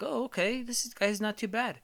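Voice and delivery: put-on high, soft baby voice. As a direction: warm up to him like a tiny baby. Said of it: "you're kinda like".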